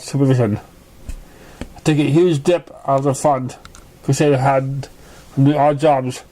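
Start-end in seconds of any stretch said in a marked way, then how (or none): none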